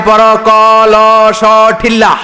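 high, loud, slow sing-song spelling drill; drawn-out recital chant; mock classroom recital. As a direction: chant it like a village teacher drilling kids. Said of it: "আবার বানান করায়".

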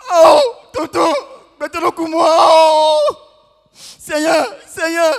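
hammed-up grief shout, high and loud; mock wailing. The kid mourning Tolegba to the uncle, hammed-up wailing. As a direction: wail to the Lord like at a funeral.

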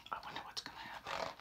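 hushed, quick, curious whisper. Acted. Larry secretly wondering about the button, whispered. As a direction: whisper the temptation to yourself. Tempted to push.

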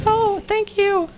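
a coy female creature, giddy; squeaky creature voice. Acted. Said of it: "that was horrible voices".

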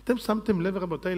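usual speaking voice

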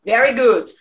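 normal talking voice

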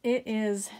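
breathless from counting candles; out of breath. Real, not acted. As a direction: talk while catching your breath. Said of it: "I'm out of breath".

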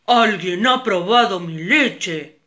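loud, slow big-bear shout; papa bear shouting loud. Acted. Papa bear finds his milk touched, loud slow shout. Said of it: "gritó muy fuerte".

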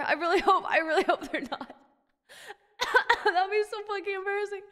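laughing through the words; embarrassed laughing. Real, not acted. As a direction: giggle in embarrassment.

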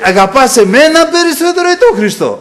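high, loud retold yell; shouted challenge. Preacher acts his brother confronting him before everyone, shouting. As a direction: demand an answer at full voice.

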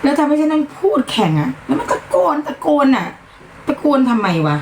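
high-pitched, exasperated complaint; losing temper. Real, not acted. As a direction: complain angrily about having to talk over shouting. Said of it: "ควบคุมอารมณ์ตัวเองไม่อยู่". (บ่นอย่างโมโห เสียงสูง)

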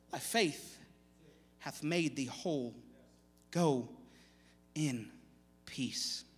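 slow, soft and low benediction; gentle blessing. Acted. Jesus to the healed woman, a soft, slow blessing. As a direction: bless her tenderly, letting each word land.

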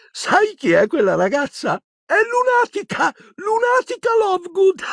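very high-pitched giddy voice breaking into laughter; barely contain hilarity, then burst out; laughing. A narrator's reading. Dazed Ron mocks Luna's nickname to Harry, laughing. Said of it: "senza smettere di ridere".

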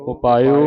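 ਉਪਾਇਓ said "opaio" with open o. Shown as a demonstration. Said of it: "ਊੜੇ ਦਾ ਮੂੰਹ ਖੁੱਲ੍ਹਾ ਹੈ".

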